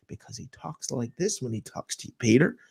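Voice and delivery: low, hushed expert impersonation; mock calm voice. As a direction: drop low and soft, mockingly composed. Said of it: "calm voice".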